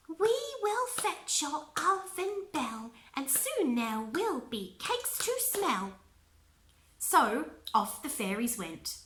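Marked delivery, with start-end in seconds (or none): 0.1-5.9 high, slow sing-song rhyme; fairy voice. Acted. Fairy promising Nessa the bell, slow high sing-song. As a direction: chant it like a little fairy.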